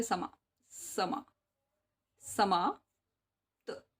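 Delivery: समाप्त stretched slowly syllable by syllable; slow syllable drill. Shown as a demonstration. Teacher spelling समाप्त aloud for pupils, slow. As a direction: sound out समा then समाप्त slowly. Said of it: "समा कैसे लिखोगे?".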